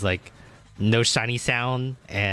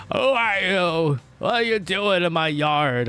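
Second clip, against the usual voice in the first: imitating a shouting homeowner; 6 semitones higher, 3 dB louder than usual. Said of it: "people are just, like, screaming".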